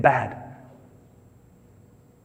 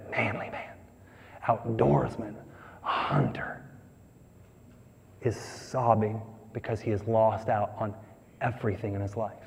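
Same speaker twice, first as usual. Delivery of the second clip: dropped to near whisper; 4 semitones lower, 11 dB quieter than usual. Real, not acted. Preacher hushes describing Esau sobbing.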